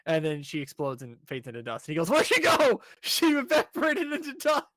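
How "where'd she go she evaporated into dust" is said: much higher and louder, a cartoonish guy gasping at the vanished girl; high character voice. Guy startled as the girl turns to dust, high put-on voice. Retelling anime scene, character voice.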